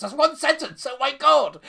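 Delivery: high, loud disbelieving exclaim; exasperated outburst. Real, not acted. Reacting to a one-sentence paragraph, loud and high. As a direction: cry out at the endless sentence.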